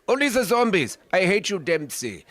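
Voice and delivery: high, loud mad scientist voice; Doctor Richtofen voice. Retold or imitated. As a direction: rant like a manic German doctor. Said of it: "Doctor Richtofen from the Call of Duty series".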